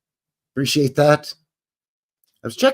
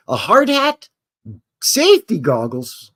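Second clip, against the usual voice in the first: ordinary talk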